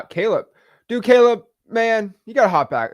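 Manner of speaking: high, loud friendly call. Real, not acted. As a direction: shout across to a buddy. Calling out to friend Caleb.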